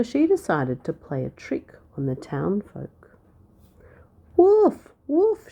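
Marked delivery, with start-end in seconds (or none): 4.4-5.5 very high, loud cry of wolf; scream. A narrator's reading. Shepherd girl fake-alarming the town, screaming. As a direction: scream it out at the top of your voice. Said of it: "she screamed at the top of her voice".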